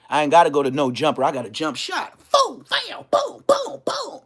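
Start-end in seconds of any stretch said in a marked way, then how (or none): 2.3-4.2 shouted gun sounds. Retold or imitated. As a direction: blast out gunshot noises. Imitating shots.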